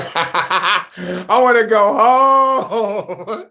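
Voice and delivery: high, slow, drawn-out whine of a tired worker; mock weary whine. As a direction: whine it out like you can't take another minute.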